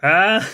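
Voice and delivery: high, loud pained yelp; pained yell. Real, not acted. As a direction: cry out from the burn. After downing the rum.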